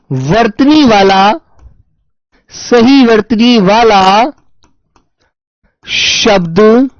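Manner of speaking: slow, word by word, as if dictating; slow dictation. Shown as a demonstration. Teacher dictating a question to students, slow and deliberate. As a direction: read the question out slowly for notes. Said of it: "dictating the question slowly".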